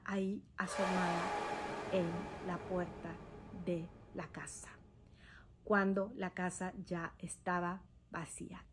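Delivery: hushed, breathy ghost-story whisper; spooky whisper. Retold or imitated. Telling listeners about the ghost at the door, in a whisper. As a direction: drop to a whisper as if the old woman could hear.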